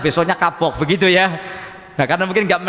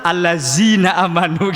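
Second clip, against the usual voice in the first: Betawi accent, "Allazina" with z instead of dh; Betawi-style reading, "Allazina" with a plain z. Shown as a demonstration. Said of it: "orang Jakarta Betai".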